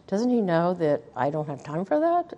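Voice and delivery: soft, low grumble to herself; muttered under breath. Retold or imitated. Wife grumbling at husband's chore request, muttered under breath. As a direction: mutter it so no one hears. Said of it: "I'm under my breath".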